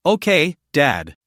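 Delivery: plain reading voice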